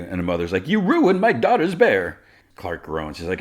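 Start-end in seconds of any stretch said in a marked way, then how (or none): 0.7-2.2 high, loud, drawn-out scolding; angry mother. Retold or imitated. Mother to the culprit over ruined bear, scolding. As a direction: imitate an outraged mother.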